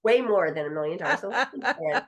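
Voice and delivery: real laughter breaking in; laughing. Real, not acted. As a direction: laugh through the punchline.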